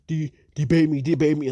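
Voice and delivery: mock whiny quote.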